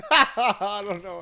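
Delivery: high, helpless laugh into the words. Real, not acted. Podcaster embarrassed by own accent attempt, laughing through the words. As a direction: crack up while disowning it, drawn out.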